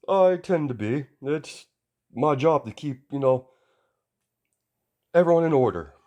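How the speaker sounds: put-on a booming hero lecturing a kid; stern hero voice. All Might scolds young Izuku, loud and high.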